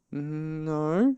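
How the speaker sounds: high, drawn-out airy denial; careless. A narrator's reading. Pooh denying he is stuck, careless. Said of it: "said Pooh carelessly".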